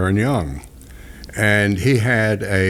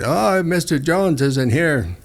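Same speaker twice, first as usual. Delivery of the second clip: put-on slow, higher Spanish-accented reply; 7 semitones higher, slower than usual. Nancy fending off fans at the gate, slow put-on Spanish accent. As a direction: lay on the fake Spanish accent, slowly. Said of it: "she put on that accent for fans".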